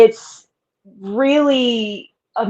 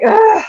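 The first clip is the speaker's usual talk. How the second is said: mock groan; 12 semitones higher, 3 dB louder than usual. Frustrated groan at mom.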